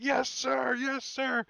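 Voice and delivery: high, loud snapped yes sir; barked military recruit reply. Retold or imitated. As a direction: shout it back like a scared recruit. Recruit answering drill sergeant.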